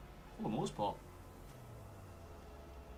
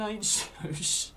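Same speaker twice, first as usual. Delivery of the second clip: slow, laughing through it; slow giggling repeat of the denial; 8 semitones higher, 4 dB louder, much slower than usual. Real, not acted. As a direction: repeat it, cracking up mid-line. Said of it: "calm down, lad".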